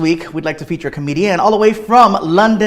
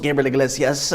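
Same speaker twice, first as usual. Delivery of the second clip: normal talking voice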